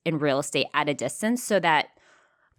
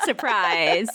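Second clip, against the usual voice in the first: laughing; 4 semitones higher, slower than usual. Real, not acted. Laughing through greeting.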